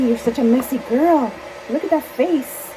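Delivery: high sing-song, slow on the last words. Real, not acted. Owner cooing at her messy baby bird, high and slow. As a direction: coo at the bird's messy face.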